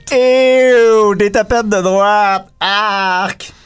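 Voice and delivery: disgusted, drawn-out groan of distaste; smelling something awful. Acted. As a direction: recoil as if it stinks. En se pinçant le nez.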